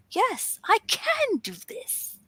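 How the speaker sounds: high, slow, stretched "can"; newfound belief. Retold or imitated. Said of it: "until you truly believe it".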